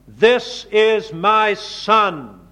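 slow, weighty divine words; slow solemn quote. Acted. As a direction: intone it slowly and solemnly. Repeats God's words slowly.

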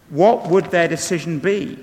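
normal talking voice

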